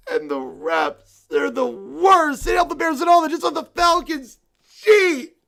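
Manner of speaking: stomp through the complaints like a sore loser; whiny tantrum. Acted. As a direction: bear fan sulking furiously at the loss. Bear rants at refs.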